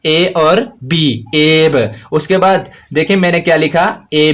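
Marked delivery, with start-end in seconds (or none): 0.1-1.8 slow spelling out. Shown as a demonstration. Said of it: "देखें B से पहले क्या था".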